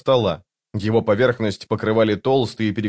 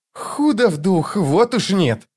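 slow, higher-pitched jeer; mocking sneer; 9 semitones higher, slower than usual. A narrator's reading. Thief scoffs at the girl's words, mocking sneer. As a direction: draw it out with derision. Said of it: "с издёвкой процедил Крокус".

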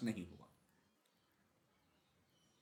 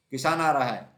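loud urgent warning shout; crow shouting; 8 dB louder than usual. A narrator's reading. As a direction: shout the alarm. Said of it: "कौवा चिल्लाया".

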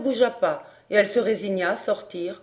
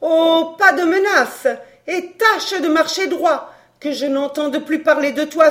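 much higher and louder threatening rant; furious threat; 5 semitones higher, 5 dB louder than usual. A narrator's reading. Countess threatening the fortune-teller, furious and loud. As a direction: threaten her with full fury.

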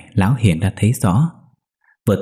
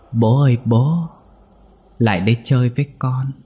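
giọng trẻ con hơi cao, nhẹ nhàng gọi bố; gọi bố ma mị như hồn trẻ con; giọng đứa con gọi; 4 semitones higher than usual. A narrator's reading. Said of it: "tiếng người gọi". (con gọi bố về chơi, giọng trẻ con hơi cao)

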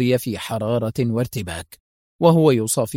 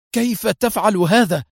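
amazed exclamation; 8 semitones higher than usual. A narrator's reading. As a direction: blurt it out in wonder. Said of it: "وهتف هارولد".

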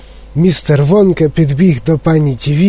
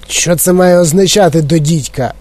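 ordinary delivery, nothing marked

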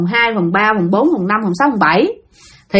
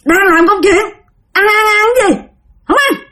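high, otherworldly voice calling back; eerie echoing voice; 9 semitones higher than usual. Acted. Strange lodger answers the landlady from behind the door, eerie and high. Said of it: "nó vang ở đâu, ở đâu vọng về vậy đó".

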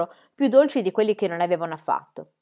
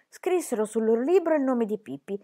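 usual speaking voice

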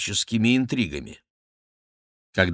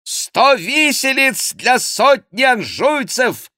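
high, loud, slow proclamation; frenzied joining cry; 6 dB louder, slower than usual. A narrator's reading. King joins the uproar against Angevins, frenzied shouting. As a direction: take up the shout with fury. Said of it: "подхватил король".

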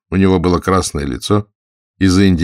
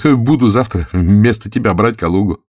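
higher-pitched character voice of a stutterer; lightly voiced stutterer; stuttering Gursky; 4 semitones higher, 4 dB louder than usual. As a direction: announce it in a raised figure voice.

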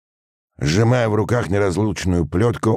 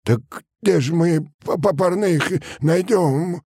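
high, stammering peasant plea; pleading; 14 semitones higher than usual. A narrator's reading. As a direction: implore the officer, stumbling in a high voice. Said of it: "взмолился мужик". (умоляюще, заикаясь)

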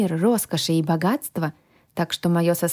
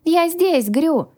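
higher, lifted call; raised voice, answering a search; called-back shout; 10 semitones higher, 3 dB louder, slower than usual. A narrator's reading. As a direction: call out to someone in the next room. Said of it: "крикнула я в ответ".

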